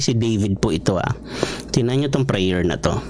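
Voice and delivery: usual speaking voice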